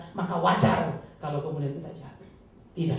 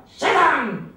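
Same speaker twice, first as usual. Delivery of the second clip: stern rebuke; 9 semitones higher, 11 dB louder than usual. Acted. Jesus rebukes Peter.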